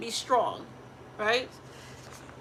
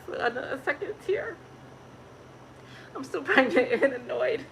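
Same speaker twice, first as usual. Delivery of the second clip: teary; 4 semitones higher than usual. Real, not acted. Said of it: "I'm emotional today".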